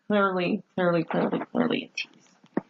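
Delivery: mock-conspiratorial whisper to the audience; fading whisper. Shown as a demonstration. Repeats line then fades to whisper.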